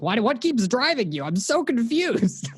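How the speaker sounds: high, confused mock whine as Smith; a hammed-up puzzled Agent Smith; frustrated Smith. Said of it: "He's so frustrated".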